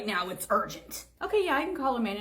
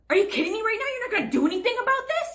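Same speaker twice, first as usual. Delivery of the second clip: high, loud indignant outburst; furious disbelief, pitched way up; outraged; 13 semitones higher, 5 dB louder, faster than usual. Acted. Customer outraged at cashier.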